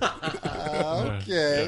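high, loud mock-hesitant reply; drawn-out skeptical okay with a laugh in it; imitating a doubtful reaction. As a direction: act out a director's dubious okay, amused. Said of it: "he looked at me like".

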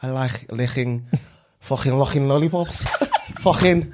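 laughing, faltering Scouse; Liverpool accent. Acted. Friend failing the lollipop challenge, Liverpool accent. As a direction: struggle through bad Scouse, cracking up. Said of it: "We'll go Liverpool".

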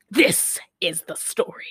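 slow, low, hushed prologue ending; ominous narrator. Acted. Host closes the film's opening text, slow ominous hush. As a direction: draw it out in a portentous near-whisper.

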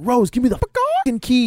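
high-pitched, mock-furious order; angry demand. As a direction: act out his impatient demand. Retold angry demand for keys.

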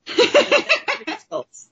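high, giggling echo; laughing repeat. Real, not acted. Cohost giggling as she repeats the new name.

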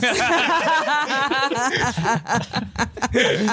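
long high cackling laugh; laughter. Real, not acted. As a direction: burst out cackling at the joke. Cracking up at joke.

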